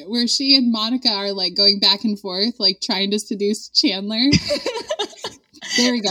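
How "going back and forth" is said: normal talking voice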